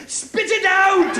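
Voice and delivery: very high, slow, loud barked order at the fly; stingy Scotsman. Retold or imitated. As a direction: make the fly give back the whiskey. Scotsman at the fly in his whiskey.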